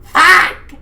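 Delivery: sudden high, loud yell; a queen crashing through her bed, shrieking; Queen's yell. Acted. Queen yelling as her bed breaks, high and loud. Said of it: "she yelled".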